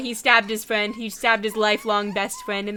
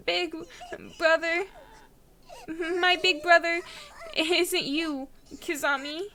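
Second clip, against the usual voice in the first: higher, slow trembling refusal; tearful defiance; 6 semitones higher, slower than usual. Acted. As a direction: push the words out through tears. Yuka defies him, tearful.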